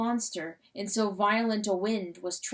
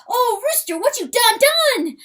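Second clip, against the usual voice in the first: very high, loud, wondering little weasel; excited weasel voice; 13 semitones higher, 9 dB louder than usual. Acted. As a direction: squeal it out in awe as the small weasel. Weasel figure speaks.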